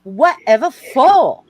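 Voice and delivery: high, loud, slow Southern shock; scandalized Southern belle outburst. Acted. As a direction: stretch it out, aghast and Southern. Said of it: "looks at her shocked and says".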